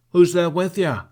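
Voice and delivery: loud, high call from a distance; yelling. A narrator's reading. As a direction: yell it across the rocks. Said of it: "Bertha yelled as she neared".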